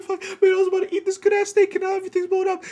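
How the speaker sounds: high, fast, frantic rant; frantic complaint. Retold or imitated. Quoting himself as the dream blows up, high fast rant. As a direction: blurt it out all at once.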